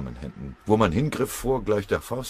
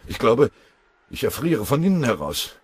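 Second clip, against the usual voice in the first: high, louder wail of complaint; whining; 6 semitones higher, 7 dB louder than usual. A narrator's reading. Freezing fitter pleading with his foreman, whining. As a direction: lament being frozen through. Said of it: "jammerte der Motorenschlosser Karl Babinski".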